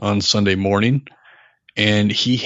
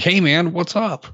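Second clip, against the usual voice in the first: friendly vendor greeting; 9 semitones higher than usual. Retold or imitated. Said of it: "vendors were like".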